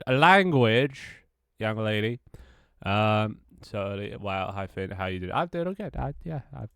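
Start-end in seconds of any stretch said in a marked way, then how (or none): 0.1-2.2 loud, drawn-out mock telling-off, pitched up; mock scolding. Streamer ticks off a swearing viewer, mock scolding. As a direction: scold playfully, stretching out LANGUAGE loudly.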